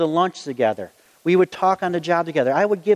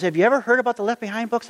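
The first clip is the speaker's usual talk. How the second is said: quick, higher-pitched eager question; excited retelling; 5 semitones higher, faster than usual. Retold or imitated. Friend asks the preacher about the books, excited and quick. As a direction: race through it with raised pitch, excited. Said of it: "I'm getting excited".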